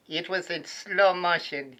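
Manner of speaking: ordinary delivery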